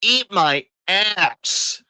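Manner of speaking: very slow, drawn-out scornful jab; scornful retort. Acted. Wrestling fan telling off a bad finish, scornful. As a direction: stretch each word right out with contempt.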